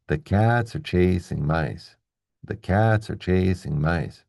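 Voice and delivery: slow, even beats on "cats", "chasing", "mice"; regular stressed rhythm. Shown as a demonstration. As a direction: land "cats", "chasing", "mice" on a steady tapped pulse. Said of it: "I'm keeping the same rhythm".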